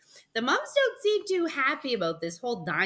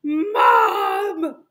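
loud, high, drawn-out shout; yelling for Mom; 8 semitones higher, 10 dB louder than usual. Acted. Boy calling his mom over to a dinosaur, yelling. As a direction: holler for your mother in amazement. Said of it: "I yelled".

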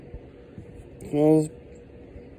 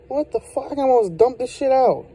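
surprised; 8 semitones higher than usual. Real, not acted. Finds dip packed down.

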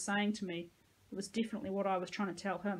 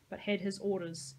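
plain reading voice

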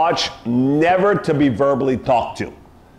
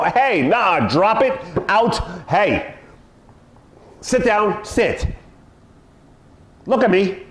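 lightly higher nagging commands to a dog; mock owner scolding dog; 4 semitones higher, slower than usual. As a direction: mimic a fussy owner bossing the dog. Owner commands mocked again.

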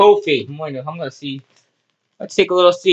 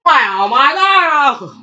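high, slow wail of play horror; mock horrified cry; 5 semitones higher, much slower than usual. Reacting to a blow in play.

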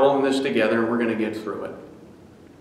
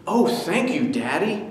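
put-on higher, happy child's thanks; delighted boy; 5 semitones higher than usual. Son thanking his father, delighted child voice. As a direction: thank him like a beaming kid. Boy beaming over money.

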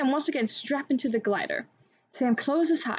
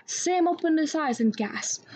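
ordinary delivery, nothing marked

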